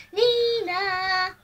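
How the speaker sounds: put-on child's sung nee-naw siren; siren noise. Cops arriving at the farm, imitated siren nee-naw. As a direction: call it out like a police siren.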